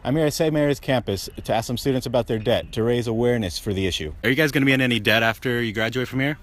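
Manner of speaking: usual speaking voice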